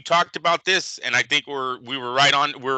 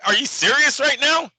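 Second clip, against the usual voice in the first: incredulous judge; 10 semitones higher than usual. Retold or imitated. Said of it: "Judge would be like".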